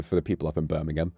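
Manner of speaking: ordinary delivery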